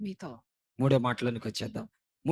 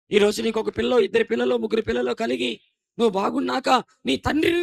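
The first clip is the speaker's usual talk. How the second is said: rising, louder list preaching; building preaching; 8 semitones higher, 9 dB louder than usual. Acted. Preacher reminding sons of parents' sacrifices, building fervent preaching. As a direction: build the list toward the climax.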